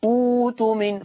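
أوت من begun on a long high "uu"; hamza as waw. Shown as a demonstration. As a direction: say أوت من as "uuu-tumin". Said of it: "فأقول".

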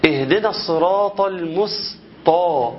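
very slow, المسطى with a thick emphatic ط; wrong heavy sin. Shown as a demonstration. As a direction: drag out المسطى as if س were ص and ت were ط. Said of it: "في حاجة غلط، ودني مش قبلاها".

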